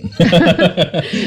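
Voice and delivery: high burst of laughter; real high-pitched laugh. Real, not acted. Host amused by his own story of fleeing his daughter's pleas, laughing.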